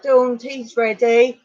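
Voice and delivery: imitating mum calling upstairs; calling up the stairs. Mum calls her daughter down for tea, a mild drawn-out call. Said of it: "she called up the stairs apparently".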